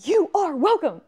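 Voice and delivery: high, loud shout; playful yell. Acted. Streamer answering a yelling viewer in chat, playful shouting. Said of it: "Why are you yelling?".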